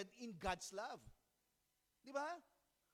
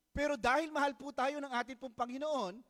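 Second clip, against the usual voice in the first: preaching at full force; 4 semitones higher, 9 dB louder than usual. Acted.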